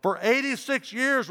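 high, loud declaration; firm reply. A narrator's reading. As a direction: answer the governor high and proud. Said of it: "replied Polycarp".

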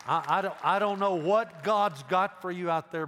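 loud, high preacher's urging; urgent, forceful pulpit plea; impassioned appeal. Real, not acted.

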